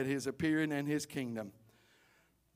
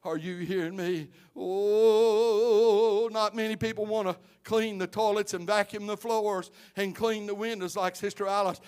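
normal talking voice

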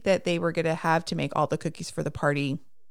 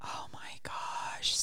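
whisper; 11 dB quieter, much slower than usual. Retold or imitated. Reacting to whispered line.

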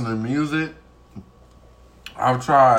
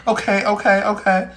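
higher, louder flustered okays; nervous people; 8 semitones higher, 4 dB louder than usual. Retold or imitated. Retelling how shaken people answer her, flustered higher. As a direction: play the startled listeners, pitched up. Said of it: "And they be like".

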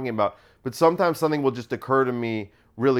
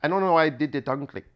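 put-on Indian accent; faster than usual. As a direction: slip into a mock Indian accent. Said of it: "Now I'm doing Indian".

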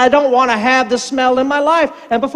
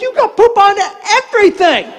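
high, mock-hysterical retort; pitched-up indignant comeback; exasperated grandpa; 8 semitones higher than usual. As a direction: shout back at a child in comic exasperation, pause before last word. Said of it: "I'm like".

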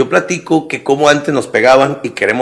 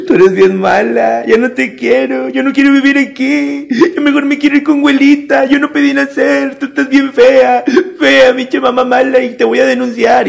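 put-on high, slow whiny child talking back; a spoiled kid throwing a tantrum; bratty kid voice; 13 semitones higher, 4 dB louder than usual. Today's kid insulting his mother, high whiny tantrum.

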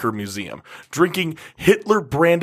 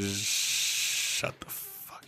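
whispering; 4 semitones lower, 14 dB quieter, much slower than usual. Real, not acted. Hushed aside to self.

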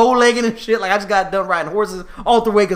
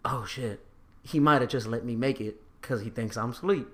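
relieved inner thought; 10 semitones lower, 12 dB quieter than usual. Retold or imitated. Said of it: "And I'm like".

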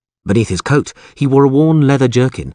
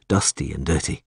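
ordinary delivery, nothing marked

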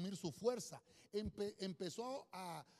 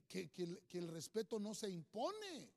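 usual speaking voice

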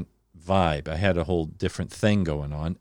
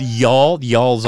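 Southern y'all; 3 semitones higher, 8 dB louder than usual. Real, not acted. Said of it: "I can't get rid of the y'all".

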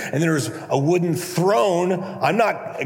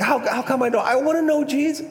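much higher, put-on plaintive voice; mock whining complaint; 8 semitones higher than usual. Believer who says they wanna know Jesus, high mock whine.

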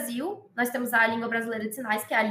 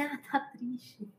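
softer, slow, mock-sad face voice; sad demo; 10 dB quieter, much slower than usual. Shown as a demonstration. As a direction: show sadness by drooping the words. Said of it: "você vai falar que alguém tá triste".

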